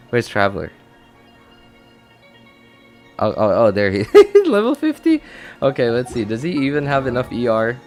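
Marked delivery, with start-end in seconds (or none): none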